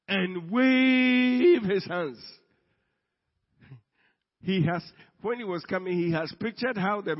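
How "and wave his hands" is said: loud, high, hugely stretched WAVE; grand proclamation. Acted. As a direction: draw out "wave" like a showman. Acting out the expected grand miracle.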